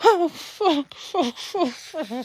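high, very loud gasped exclamations; real yelping outburst; overwhelmed crying out. Real, not acted. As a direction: burst out high and loud, losing control. Overwhelmed after turning it up.

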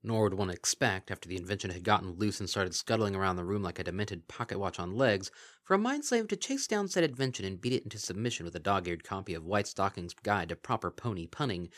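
ordinary delivery, nothing marked

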